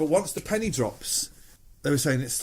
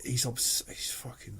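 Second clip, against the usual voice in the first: awed whisper; 8 dB quieter, slower than usual. Real, not acted. Awed by Pep mid-sentence.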